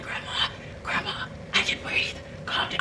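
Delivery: voiceless, choked whisper; can't really talk. Retold or imitated. Choking patient telling grandmother to calm down, strained whisper. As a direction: imitate gasping words out with no voice. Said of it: "I can't really talk".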